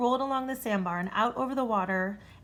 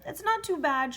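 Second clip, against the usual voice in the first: shrieking; 7 semitones higher than usual. A narrator's reading. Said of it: "shrieked the dragon".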